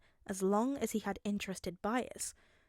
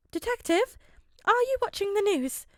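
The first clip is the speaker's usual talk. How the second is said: high, louder, urgent worried voice; worried principal; 12 semitones higher, 7 dB louder than usual. A narrator's reading. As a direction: call him in alarm. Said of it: "Nezu's voice sounded worried".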